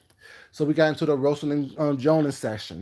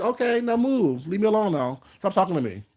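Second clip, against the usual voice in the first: retold shooing away; 7 semitones higher than usual. Dismissing coworker after roast.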